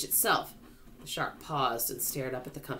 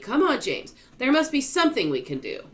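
high, loud pleading; anxious, raised voice; urgent urging; 11 semitones higher, 7 dB louder than usual. A narrator's reading. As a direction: press him desperately. Centipede urges James desperately.

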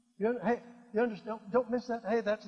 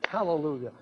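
ordinary talk

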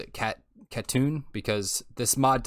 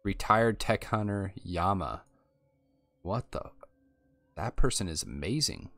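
ordinary delivery

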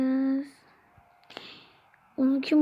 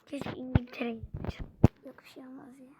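hushed unvoiced wish under the breath; whispered aside; 3 semitones lower, 9 dB quieter, faster than usual. Real, not acted. Gamer whispering an aside to viewers, hushed. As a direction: whisper it to yourself. Aside while playing football game.

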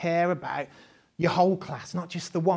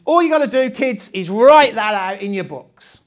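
bossy teacher; 3 semitones higher, 11 dB louder than usual. Acted. Teacher voice giving lazy Friday task.

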